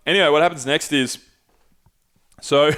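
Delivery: ordinary delivery, nothing marked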